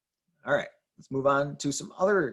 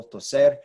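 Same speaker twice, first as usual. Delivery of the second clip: toser with a louder final -ser; stress on last syllable; 6 dB louder than usual. Shown as a demonstration. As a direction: punch the end of Spanish toser. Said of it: "stress that last syllable".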